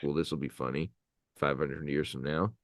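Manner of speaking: a soft, low mock prankster voice; hushed prankster aside. Librarian voicing the ancient prankster, softly and low. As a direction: murmur it low like a sly joker. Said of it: "just being like".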